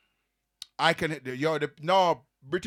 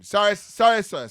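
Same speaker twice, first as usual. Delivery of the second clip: loud, raised mock apology; 5 semitones higher, 8 dB louder than usual. Guest hams up an apology to the offended host, louder. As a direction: overdo the apology for fun.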